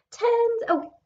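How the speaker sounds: excited. Acted. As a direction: cry out with joy at finding him. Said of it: "said Billy".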